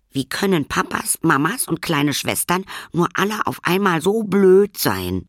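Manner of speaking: high, frustrated complaint; a child's indignant rant; grumbling scold. Acted. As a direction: vent at everybody. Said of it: "schimpfte Lilly".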